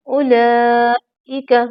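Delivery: उलाइका with a short उ and no long vowel, slow; उलाइका slow, the first vowel not held. Shown as a demonstration. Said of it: "वाव पर कुछ नहीं है".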